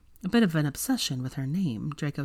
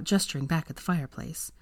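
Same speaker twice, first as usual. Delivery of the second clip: usual speaking voice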